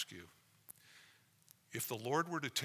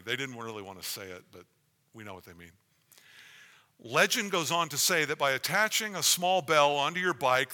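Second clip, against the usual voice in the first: spooky ghost tour hush; 3 semitones higher, 10 dB louder than usual. Acted. Reads bell legend aloud dramatically.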